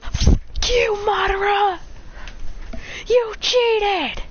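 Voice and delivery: high-pitched gamer shouting; squealed, furious accusation; angry yell. Acted. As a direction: yell it like a sore loser.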